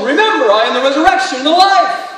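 loud, high proclamation; elevated tone. Acted. Imagined reaction before raising Lazarus, in an elevated tone. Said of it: "speak with an elevated tone".

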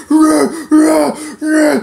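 loud, high-pitched animal grunting; mock gorilla roars. Mocking a noisy lifter who slams weights, gorilla roars. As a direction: roar like a show-off gorilla.

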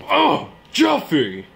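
slow, loud drawn-out groan of the name; exasperated cry. Acted. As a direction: call him out in exasperation. Father exasperated by silent son.

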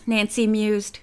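usual speaking voice

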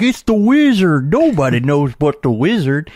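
high, loud imitation of a truck driver's accent; Mickey's accent. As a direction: call it out in a put-on trucker accent. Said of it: "he had this accent".

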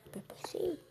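breathy secret whisper; whispered aside. Pretend. Susurro tras decir que no tendrá nada. (susurro cómplice)

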